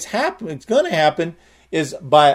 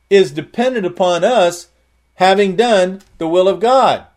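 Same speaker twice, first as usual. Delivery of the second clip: ordinary delivery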